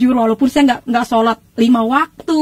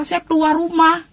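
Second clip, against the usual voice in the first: ordinary talk, nothing marked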